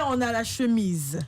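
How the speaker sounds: normal talking voice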